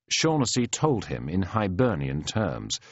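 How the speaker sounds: ordinary delivery, nothing marked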